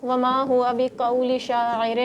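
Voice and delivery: very slow, drawn-out recitation. Real, not acted. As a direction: chant each word slowly. Verse recited again slower. (بہت آہستہ تلاوت)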